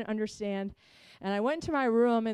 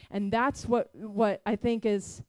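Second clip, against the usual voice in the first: ordinary talk, nothing marked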